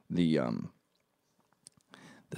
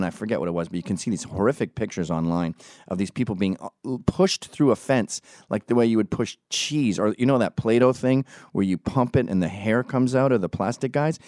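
usual speaking voice

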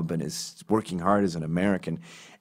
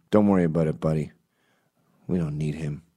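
mock consoling; 5 semitones lower than usual. Stranger consoling Nick after David leaves, lower. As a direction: comfort him like a loyal stranger.

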